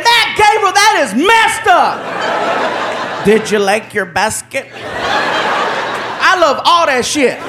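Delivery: high, slow, laughing complaint; laughing protest. Retold or imitated. Guest laughing at the prank, protesting. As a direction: moan it through laughter.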